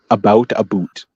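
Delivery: "About a boot" with a Canadian-style "aboot"; mock Canadian "aboot". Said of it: "gets pronounced differently is a boot".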